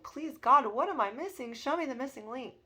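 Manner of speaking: acted-out plea for help; pleading. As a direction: beg desperately for an answer. Retold plea to God.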